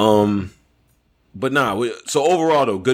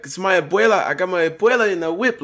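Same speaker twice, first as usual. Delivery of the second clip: higher, lightly voiced defence of him; imitating a friend; 7 semitones higher than usual. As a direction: play him up, a bit pleading. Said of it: "he's like".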